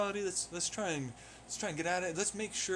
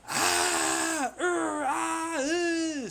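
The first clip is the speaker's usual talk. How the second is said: loud, high screaming cries; 10 semitones higher, 7 dB louder, much slower than usual. Acted. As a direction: cry out in drawn-out screams. Wordless screaming, then names screaming.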